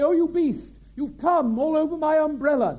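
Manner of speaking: very high, very loud scandalised shriek; outraged gallery lady. Retold or imitated. A woman in the gallery crying out in shocked outrage, very high and loud. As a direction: screech in horror from the balcony. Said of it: "I heard a voice in the gallery say".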